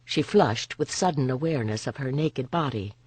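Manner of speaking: usual speaking voice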